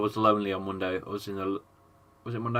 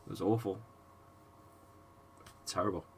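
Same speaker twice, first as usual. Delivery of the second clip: hushed distress; 3 semitones lower, 12 dB quieter, faster than usual. Real, not acted. Recalling daughter's scary episode.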